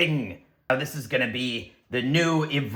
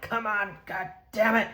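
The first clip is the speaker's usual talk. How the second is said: much higher, strained cursing; frustrated curse; 8 semitones higher, 4 dB quieter than usual. Acted. Straining at the stuck pod, higher frustrated cursing. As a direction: force it open, swearing at it. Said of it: "I'm like".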